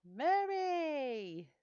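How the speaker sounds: high, loud, drawn-out call of a name; Mary! called high and long, falling at the end. Real, not acted. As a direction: sing out Mary! like spotting a friend across a room. Greeting a viewer by calling out.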